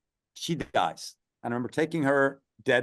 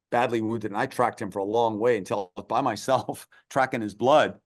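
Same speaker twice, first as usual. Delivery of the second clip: normal talking voice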